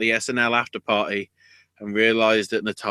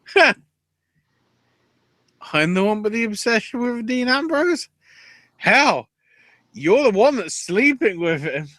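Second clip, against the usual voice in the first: mocking retort; 10 semitones higher than usual. Mocking retort quoted in rant.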